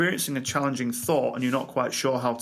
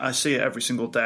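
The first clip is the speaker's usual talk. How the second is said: ordinary talk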